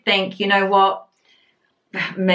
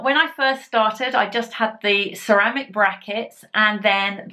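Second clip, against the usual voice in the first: talking with fingers in mouth corners; stretched lips while speaking; lips pulled apart by fingers. Real, not acted. Seen 1: fingers pull lips apart to show braces, brief breaks near 4s.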